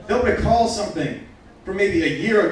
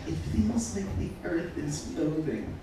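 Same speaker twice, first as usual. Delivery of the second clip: put-on breathy girlfriend; 10 dB quieter than usual. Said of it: "she was like".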